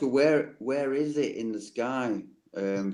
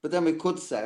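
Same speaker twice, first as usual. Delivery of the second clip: ordinary talk, nothing marked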